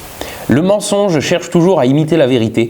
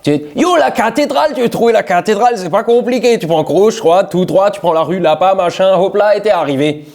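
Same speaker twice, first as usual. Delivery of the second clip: put-on strong Alsatian accent, pitched higher, quick and chatty; 4 semitones higher than usual. Giving a lost tourist directions to the cathedral, in thick Alsatian accent. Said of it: "à lui répondre comme ça".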